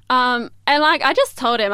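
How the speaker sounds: normal talking voice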